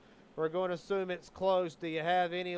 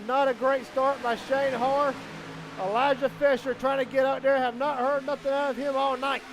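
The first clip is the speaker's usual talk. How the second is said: raised, driving race commentary; live race play-by-play; 6 semitones higher, 7 dB louder than usual. Real, not acted. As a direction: call the laps with building urgency.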